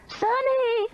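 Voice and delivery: high screamed name. A narrator's reading. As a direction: scream for the missing man. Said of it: "Meemaw screamed".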